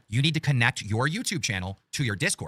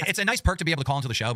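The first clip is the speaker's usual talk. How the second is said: ordinary delivery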